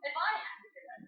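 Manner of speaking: high exasperated coach scolding; mock scolding. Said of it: "he looks at me and he goes".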